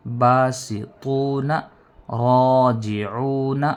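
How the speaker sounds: slow, drawn recitation of each word from the throat; recited with tajweed. Shown as a demonstration. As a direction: recite each word with long vowels, attention on the throat letters. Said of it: "تو اس کو پڑھ لیتے ہیں رواں سے".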